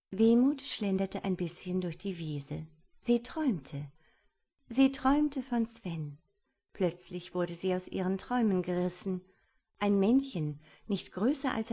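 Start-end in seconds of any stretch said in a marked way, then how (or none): none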